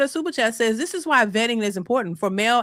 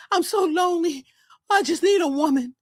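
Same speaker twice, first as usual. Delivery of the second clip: higher-pitched mock lament; hammed-up lonely begging, no real tears; 5 semitones higher than usual. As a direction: lightly imitate a tearful plea. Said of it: "begging and crying and snot nose and saying".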